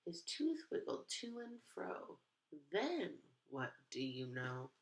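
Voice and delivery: put-on lively read-aloud to kids; expressive picture book narration; storybook reading. A narrator's reading. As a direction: read it like storytime for little ones.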